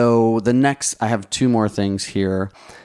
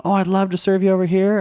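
light Southern lilt, pitched much higher; Southern operator voice; 10 semitones higher than usual. Retold or imitated. Imitating the Southern operator inviting a guest over, higher Southern lilt. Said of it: "She had this Southern voice".

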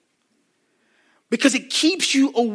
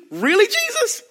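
much higher, incredulous whine on Jesus; exasperated disbelief; 14 semitones higher than usual. Acted. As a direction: throw up your hands at Jesus.

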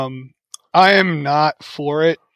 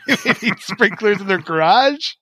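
laughing; 5 semitones higher than usual. Real, not acted. Laughs while joking.

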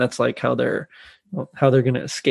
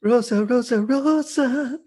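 Rosa impression; 10 semitones higher, slower than usual. Echoing the Rosa voice.

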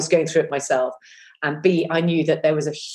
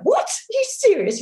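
much higher, startled outburst; shocked disbelief. Retold or imitated. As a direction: act the jolt of disbelief. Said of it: "I'm like".

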